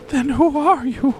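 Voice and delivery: put-on old wife falsetto. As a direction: gasp it like a startled old wife. Acted couple dialogue.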